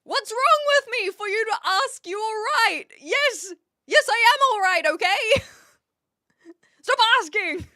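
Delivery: high, loud, defensive outburst; loud exasperated protest. Pretend. To Brits who keep asking, a loud exasperated protest. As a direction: snap back that you're fine, stop asking. Mock defensive reply to greeting.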